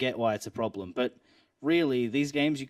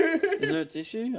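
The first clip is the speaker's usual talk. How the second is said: mock high echo; 13 semitones higher, 3 dB louder, slower than usual. Echoes friend's nosebleed plea mockingly.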